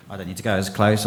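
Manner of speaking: ordinary talk, nothing marked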